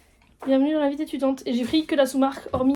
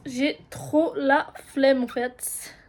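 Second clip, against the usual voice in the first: drawn-out whine; 4 dB louder, much slower than usual. Real, not acted. Complaining about chores.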